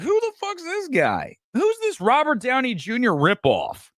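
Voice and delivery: high, incredulous heckle; mock an outraged crowd sizing up a newcomer; imitated heckling crowd. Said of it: "everybody like".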